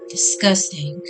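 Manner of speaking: ordinary talk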